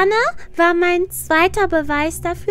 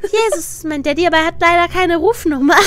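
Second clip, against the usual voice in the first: ordinary talk, nothing marked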